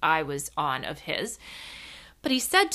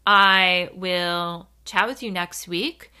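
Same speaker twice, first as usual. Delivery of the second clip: ordinary delivery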